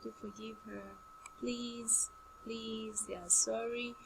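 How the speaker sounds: soft pleading mother's voice. Retold or imitated. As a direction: plead softly for the children with daddy. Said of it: "she always stands in gap to say".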